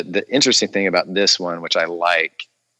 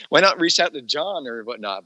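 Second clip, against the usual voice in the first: higher, incredulous question; disbelieving, much higher; retold disbelief; 8 semitones higher than usual. Actor retelling his surprise at being asked. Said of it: "I'm like".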